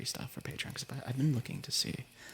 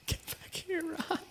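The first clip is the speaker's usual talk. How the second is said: much higher squeaky Ra voice; Ra impression; 4 dB quieter, slower than usual. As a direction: squeak it out like the character Ra. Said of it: "Is that a good Ra?".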